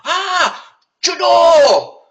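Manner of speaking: high, loud shout of sudden understanding; sudden realization. Retold or imitated. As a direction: it finally clicks, shout it out. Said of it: "all of a sudden he'd say".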